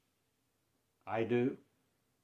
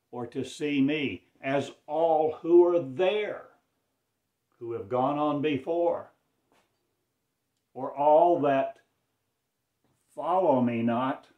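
higher and louder, building to a preaching peak; swelling proclamation; 5 semitones higher, 7 dB louder than usual. Acted. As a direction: lift the voice like a prophet at full force. Sermon climax, voice swells.

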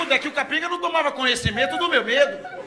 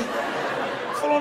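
usual speaking voice

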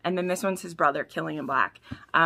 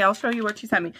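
ordinary talk

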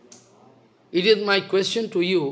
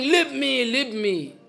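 mock patient's cry; 4 semitones higher, slower than usual. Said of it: "the patient shouting".

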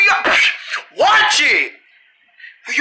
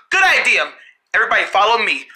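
usual speaking voice

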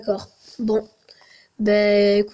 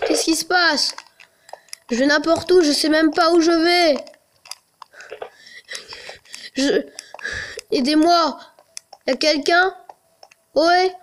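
panicky; 5 semitones higher than usual. Real, not acted. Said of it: "je me sens mal".

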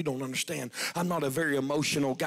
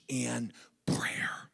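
slow breathy whisper; preacher closing the story in a whisper; 4 semitones lower, 5 dB quieter than usual. Acted. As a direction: whisper it as the key truth. Whispered lesson.

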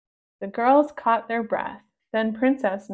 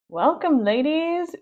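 louder, higher, gushing car salesman; salesman pug voice; 5 semitones higher, 5 dB louder than usual. Acted. Pug salesman greets the girls, gushing and loud. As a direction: flatter the girls like a slick seller.

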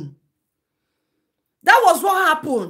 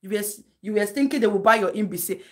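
ordinary talk

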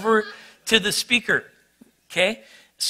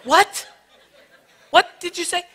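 much higher, laughing disbelief; surprised; 13 semitones higher, 5 dB louder than usual. Retold or imitated. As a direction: react stunned at the odd question. Caught off guard by question.

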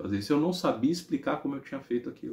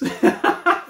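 short, high burst of laughter; 6 dB louder than usual. Real, not acted. Storyteller amused by mother's question, laughing loudly. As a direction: laugh out loud briefly.

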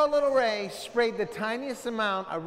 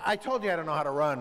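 ordinary delivery

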